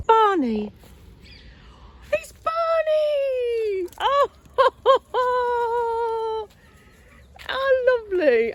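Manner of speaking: high, delighted call of a name; excited greeting. Real, not acted. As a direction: squeal with joy at the dog.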